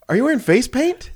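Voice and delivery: high-pitched, puzzled mock question; squinting question. Older coworker squinting at a customer, squinting question. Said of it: "he squints his eyes and looks at her".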